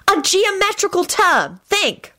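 high, slow insistent yell; exasperated yell. A narrator's reading. Hermione pushing baffled Lupin toward the answer, yelling. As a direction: spell it out slowly at a high, yelling pitch. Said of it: "Hermione yelled".

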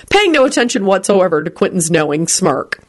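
plain reading voice

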